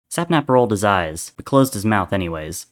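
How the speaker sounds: plain reading voice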